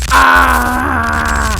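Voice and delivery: sudden loud yell of fright; loud scream. Real, not acted. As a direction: scream at the sight. Creature emerges.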